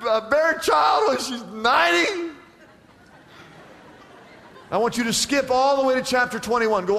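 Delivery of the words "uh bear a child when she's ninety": high, mock-amazed shout; acting out astonishment at the absurd news; incredulous acted outburst. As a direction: hit the question as if it were preposterous. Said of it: "he's just down there laughing".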